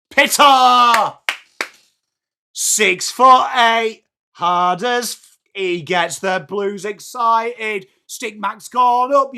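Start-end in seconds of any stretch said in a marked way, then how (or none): 0.1-1.1 high, loud cry of the player's name; cheering shout. Real, not acted. Cheering a goal. 2.5-7.9 slow relishing praise. Real, not acted. Savouring praise of a player.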